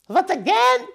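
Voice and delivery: high and loud with teeth clamped; mumbling through stuck teeth. A narrator's reading. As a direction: speak with jaws glued shut. Witch answering through stuck teeth.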